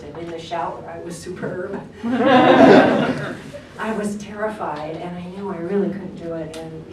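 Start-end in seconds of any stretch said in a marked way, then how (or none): 1.9-5.0 laughing out the words, slowly. Real, not acted. Telling the panel about the recording, laughing. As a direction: laugh while admitting the fear.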